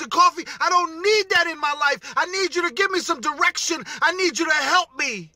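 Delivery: high-pitched, whiny mock daughter voice; upset daughter. Daughter begging her dad for direction, high and whiny. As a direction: plead in a put-on high voice, exasperated.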